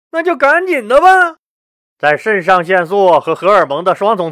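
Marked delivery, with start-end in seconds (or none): 0.1-1.4 very high, impatient emperor; hurry-up command pitched way up; eager urging. A narrator's reading. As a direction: urge them on in a high, keyed-up voice. Eager emperor urges the conjurer.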